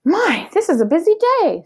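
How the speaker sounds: high, cheerful character voice; Amelia Bedelia voice. Acted. Teacher reading aloud, Amelia busy with chores, high voice. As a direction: pitch up, bustling maid.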